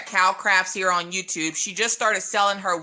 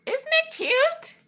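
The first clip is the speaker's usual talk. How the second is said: much higher, slow gushing question; high slow squeal of approval; cutesy gush; slower than usual. Real, not acted. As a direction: coo over how adorable it is. Showing off a cute bag.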